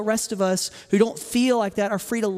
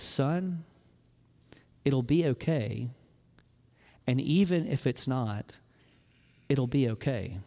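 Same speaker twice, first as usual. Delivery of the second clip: low, soft fatherly reassurance; tender reassurance; 4 semitones lower, 4 dB quieter, slower than usual. Retold or imitated. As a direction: gently comfort a scared son, low and soft. Scared son, dad reassures him before surgery.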